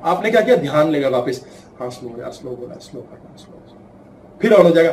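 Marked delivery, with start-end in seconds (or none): 1.8-3.6 low, hushed murmur to yourself; quietly calm yourself down; soft self reminder. Retold or imitated. Inner voice reminding.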